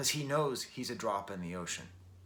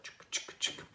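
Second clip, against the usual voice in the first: soft unvoiced clicky chick chick chick; guitar chick imitation; 5 dB quieter than usual. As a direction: mimic a chucking guitar under your breath. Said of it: "kind of a chucking guitar".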